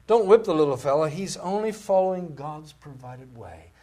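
loud plea from the pulpit, slowing down; emphatic preaching. Real, not acted. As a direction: preach it loudly, then stretch it out.

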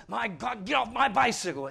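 a hint of a bossy little boy; child's playground shout. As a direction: boss another kid off your bike. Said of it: "Junior has to go up to other little Junior and says".

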